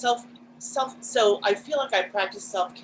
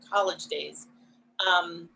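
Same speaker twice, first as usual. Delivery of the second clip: ordinary talk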